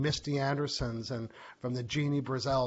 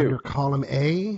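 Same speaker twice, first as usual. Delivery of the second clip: bingo caller; 9 dB louder than usual. Pretend. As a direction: call it like a bingo hall caller. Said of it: "sound like a bingo caller".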